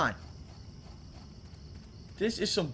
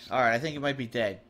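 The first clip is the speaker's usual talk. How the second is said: usual speaking voice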